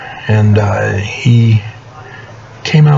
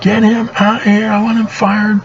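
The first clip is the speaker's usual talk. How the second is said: mock scream; 12 semitones higher than usual. Furious boss demanding a worker be fired, mock screaming. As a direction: imitate a furious man screaming, an octave up. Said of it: "went running out screaming".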